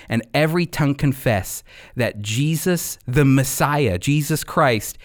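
normal talking voice

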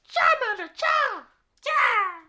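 high slow creature call; Charmander cry. Pretend. Cohost tries Charmander's cry, high and slow. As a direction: squeak it out like a baby fire lizard. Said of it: "what the Charmander".

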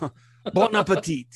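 hammed-up French waiter; French accent. French waiter serving the dish, French accent.

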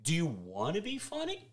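imagined Bale scoffing at jokes, hammed-up and laughing; mock Bale rant. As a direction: ham up an indignant Bale. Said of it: "And Taiki said".

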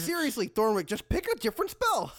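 rising to a high yell; exasperated plea. Acted. Fighter to wizard mid-fight, exasperated. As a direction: urge your friend, fed up and urgent.

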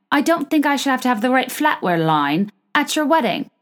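put-on high-pitched affected hybrid accent; put-on accent, indignantly telling off a bride; Dorit. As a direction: do a hammed-up bad celebrity impression, pitched well up. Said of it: "I know my accent or my, um, impressions are god-awful".

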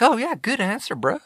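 mock impressed. A viewer mocking a debate non-answer, fake impressed. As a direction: fake awe at a politician's dodge. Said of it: "And you're like".

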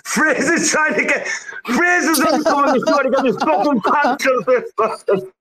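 very high, helpless laughing protest; laughing while talking. Real, not acted. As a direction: squeal the words through real laughter. Cracking up at the requested line.

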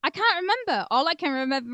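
ordinary delivery

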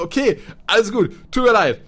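high and loud, hurried appeasing; placating retort. Retold or imitated. To the offended therapist, loud high-pitched appeasing. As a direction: throw up hands verbally, calming him down.

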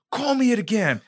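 high, strained retold threat; imitated furious challenge; mock angry threat. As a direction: bark it while swinging.

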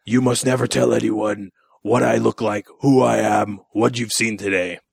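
lightly voiced monster laying down terms; a hint of a solemn creature voice; menacing gargoyle. Gargoyle demanding secrecy from the man it spared, solemn creature voice.

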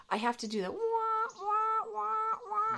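high, muffled wah wah like a warning horn; muffled wah wah talk. Shown as a demonstration. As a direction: sing the wah wahs high like an alarm. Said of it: "warning here".

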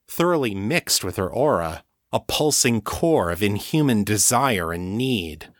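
usual speaking voice

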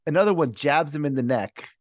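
ordinary talk

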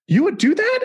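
high, stunned mock-tearful question; a hint of happy tears in the disbelief. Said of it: "she burst into tears and she's like".

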